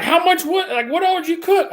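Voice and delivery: very high, incredulous imitating of the boss; shrill boss. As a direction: squeak it out in disbelief. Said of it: "she's like".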